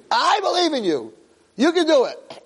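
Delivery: high, fervent pep talk; emphatic encouragement. Retold or imitated. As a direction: cheer a hesitant friend on. Cheering the friend on.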